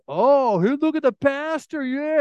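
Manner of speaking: high, loud mock heckling; jeering beer drinker. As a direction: imitate a rowdy guy razzing the pastor. Rowdy beer drinkers spot pastor.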